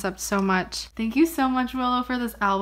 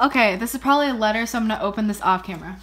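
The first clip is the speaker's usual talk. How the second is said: normal talking voice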